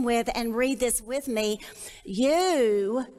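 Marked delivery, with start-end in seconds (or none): 2.0-3.1 "You" leaned on and held; stress on you. Shown as a demonstration. Teacher to the women, stressing "You". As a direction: hit the word "You" hard to open the verse. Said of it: "I want you to emphasize that word".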